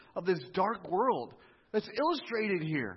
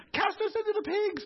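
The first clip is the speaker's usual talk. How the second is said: very high-pitched mock spirit voice; hammed-up high demon plea; evil spirits pleading; 13 semitones higher than usual.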